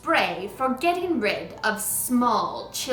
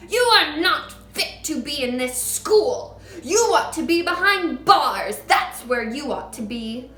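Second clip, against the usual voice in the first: shouting; 6 semitones higher, 9 dB louder than usual. A narrator's reading. Trunchbull shouting insults at Matilda. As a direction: pound out each insult.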